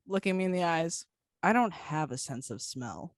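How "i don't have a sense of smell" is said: low, deadpan delivery of a stranger. Retold or imitated. Girl answers the smell joke looking him in the eyes, deadpan. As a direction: say it deadpan, pitched well down. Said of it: "She goes, deadpan".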